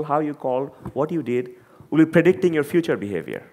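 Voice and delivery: normal talking voice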